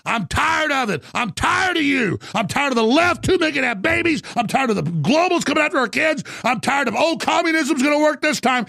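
high, strained rant, building; fed-up tirade. Real, not acted. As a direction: hammer out each grievance, pitch climbing. Fed-up tirade before he stops himself.

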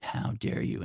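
breathy mocking whisper; whispered how dare you. Retelling the manager's line, mocked in a whisper. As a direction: hiss it under the breath, mocking. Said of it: "And it's like".

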